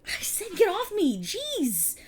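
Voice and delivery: high, loud, drawn-out exasperation; annoyed protest. Acted. Shoving the other person away.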